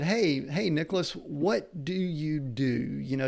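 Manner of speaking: ordinary delivery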